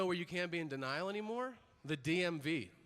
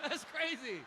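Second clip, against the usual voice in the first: high-pitched, laughter-filled exclamation; hammed-up giggling; 11 semitones higher than usual. Patient cracking up at doctor's joke, giggling. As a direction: squeak it out through acted laughter. Acting patient helplessly amused mid-exam.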